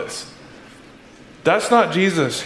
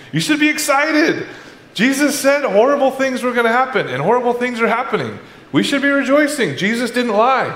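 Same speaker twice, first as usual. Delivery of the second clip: fervent; 9 semitones higher than usual. Real, not acted. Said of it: "preaching fervor".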